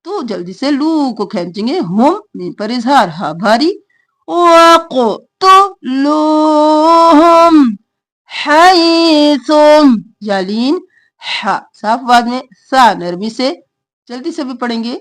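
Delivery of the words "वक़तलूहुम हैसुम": slow, drawn-out tajweed chant; Quran recitation. Shown as a demonstration. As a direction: recite it slowly, stretching the vowels. Reciting verse for students.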